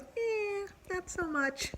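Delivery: a mock shrugging brush-off; dismissive shrug. Retold tree guy shrugging it off.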